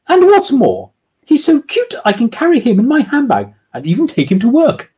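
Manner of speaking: lightly voiced Jemima; delighted lady. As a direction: boast about the dog with delight. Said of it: "doing women's voices".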